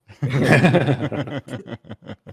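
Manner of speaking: big high burst of laughter; loud laughter. Real, not acted. Friend cracking up at the pun titles, loud laugh. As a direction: crack up loudly at the joke.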